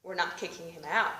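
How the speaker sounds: low, soft, unbothered; low, dismissive shrug; shrugging cops. Retold or imitated. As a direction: brush it off like a bored cop. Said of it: "They're like".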